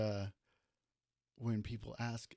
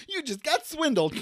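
higher, louder gloating villain; mustache twirling villain; 13 semitones higher, 6 dB louder than usual. Pretend. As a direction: crow it like a cartoon crook. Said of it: "twirl my mustache".

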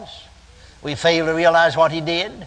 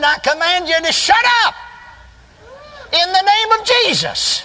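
high, forceful rebuke ringing out; desperate command. Retold or imitated. Preacher reenacting his command to the spirit, high desperate rebuke. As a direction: order the spirit away at full pulpit pitch. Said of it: "I said, (in desperation)".

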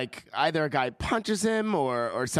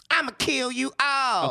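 higher slow action hero attempt; eager audition; 7 semitones higher, slower than usual. Shown as a demonstration. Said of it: "Can you try?".

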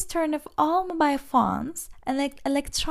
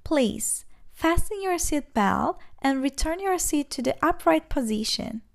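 normal talking voice

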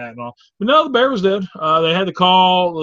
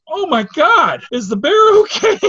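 very high, louder panicked squeal; frantic hippie; 14 semitones higher, 9 dB louder than usual. Retold or imitated. Hippie trucker running up to the crash, frantic squeal. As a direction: gush out worried about the bear. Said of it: "runs up to us and goes".

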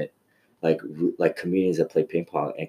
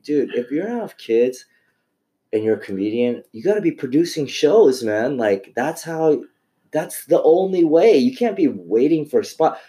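retold pep talk; 6 semitones higher, 5 dB louder than usual. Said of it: "I'm like".

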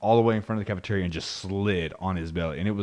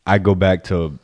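ordinary delivery

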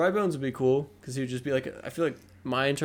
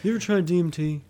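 Joe Rogan impression; 4 semitones higher, 3 dB louder, faster than usual. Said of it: "Joe Rogan Funky Kong".

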